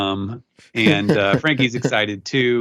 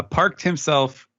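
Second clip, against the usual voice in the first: ordinary talk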